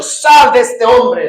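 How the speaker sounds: loud, slow, firm command. Acted. Jesus rebuking the spirit inside the man, slow and loud. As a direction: order the spirit out, slowly. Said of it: "Le hablaba a lo que estaba dentro".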